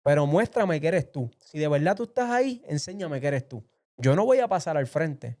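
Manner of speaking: high, insistent demand to God; reenacted impassioned plea, pitch leaping up; retold prayer aloud. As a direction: challenge God to prove Himself. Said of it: "hablé en voz alta y dije".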